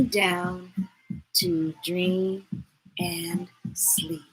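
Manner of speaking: soft, drifting sleepy reading; lullaby hush. Acted. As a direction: let the words fade like a lullaby. Lulling the child to sleep.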